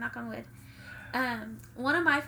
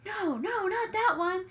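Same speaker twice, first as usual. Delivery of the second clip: higher, insistent mock protest; mock exasperated objection; 9 semitones higher, 4 dB louder, slower than usual. Podcaster retelling Carter stopping the French anthem, mock exasperated. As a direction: cut off the wrong song, imitating exasperation.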